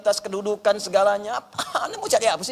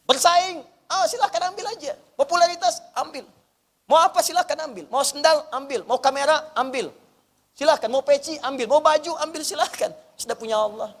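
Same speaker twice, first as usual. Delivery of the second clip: high, brisk dismissive offers; acted carefree indifference; 8 semitones higher than usual. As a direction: give everything away without a care. Acting carefree surrender to rivals. (mempersilakan dengan nada tinggi, tak peduli)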